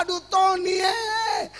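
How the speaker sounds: mock tearful outcry at a funeral; imitating crying out. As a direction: wail the name like a weeping mourner. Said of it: "Dia menangis, dia berkata".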